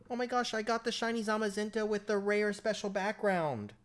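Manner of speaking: high bragging gamer parody; mocking voice. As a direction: squeal it like a show-off. Mocking bragging players again.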